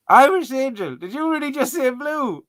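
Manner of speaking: high-pitched, incredulous outburst; loud squeal easing into high disbelief; mock disbelief. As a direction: call them out in exaggerated shock. Mock outrage at wrong quiz answer.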